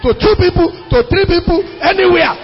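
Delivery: high, impassioned pulpit delivery; full-force preaching. Real, not acted. Pastor telling church why he preaches, full-force preaching. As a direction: preach it hard, pitch lifted.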